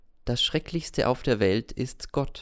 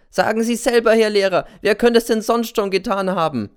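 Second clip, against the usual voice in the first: angry shout; 11 semitones higher, 7 dB louder than usual. Acted. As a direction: hurl the question at him. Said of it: "schreit der Z".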